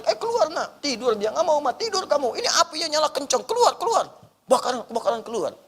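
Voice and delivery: high-pitched parent raising the alarm; acted urgent alarm. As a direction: urge a sleeping child out of danger. Acted parent urging child from fire. (menirukan orang tua panik membangunkan anak)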